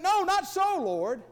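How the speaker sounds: high, loud protesting cry; mock protest. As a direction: Peter blurting out in alarm at his Lord. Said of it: "Peter's like".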